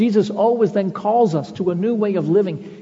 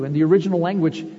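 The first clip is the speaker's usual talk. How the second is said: ordinary delivery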